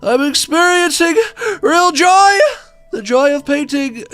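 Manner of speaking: high, loud cry of joy; a hammed-up burst of bliss; ecstatic cry. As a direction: shout it out in painterly rapture. Ecstatic shouted exclamation.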